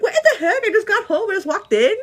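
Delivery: high, loud, laughing protest; mock indignant disbelief. Worker just home and already told it's bedtime, mock indignant. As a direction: exclaim it high and loud, half laughing in outrage. Acted disbelief that evening is gone.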